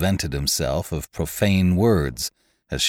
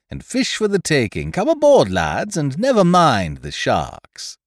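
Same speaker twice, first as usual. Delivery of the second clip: loud, high, gleeful mock welcome; mocking invitation; 10 semitones higher, 4 dB louder than usual. A narrator's reading. Pirate on deck mockingly inviting starving men aboard. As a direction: taunt them with a cheery sham welcome. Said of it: "Ned Low made laughing response".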